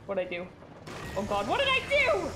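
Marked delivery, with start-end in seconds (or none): 1.2-2.3 high, rising alarm; panicked cry. Real, not acted. Player triggered something in game, panicked cry. Game goes suddenly wrong.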